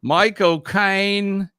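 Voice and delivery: drawn-out, high and loud mock-British accent; put-on British accent. Host calls out the game answer, put-on British accent. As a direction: stretch the name in a British accent. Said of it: "You liked my accent?".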